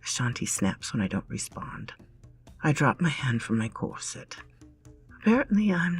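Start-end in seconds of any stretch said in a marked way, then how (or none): none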